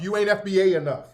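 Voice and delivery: usual speaking voice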